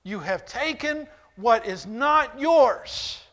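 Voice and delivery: loud, high pained demands; anguished outcry. Acted. Preacher voicing God confronting David, loud and pained. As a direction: cry out why as a wounded God. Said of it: "And he's saying".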